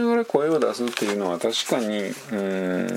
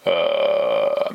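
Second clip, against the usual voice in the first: relaxed burp area voice; 5 semitones lower, 4 dB louder than usual. Shown as a demonstration. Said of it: "ゲップエリアは完全に力抜きますよ".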